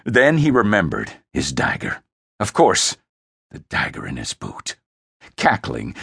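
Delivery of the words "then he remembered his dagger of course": ordinary delivery, nothing marked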